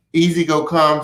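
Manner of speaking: ordinary delivery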